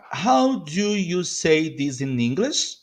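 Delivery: How do you say this in English, slow and word by word; slow model. Shown as a demonstration. Teacher modelling a question for learners, slowly.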